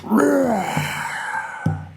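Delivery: high raging roar; enraged tiger roar. A narrator's reading. Tiger raging at the toad in the rock, high furious roar. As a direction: let the tiger howl in fury. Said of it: "the tiger flew into a rage".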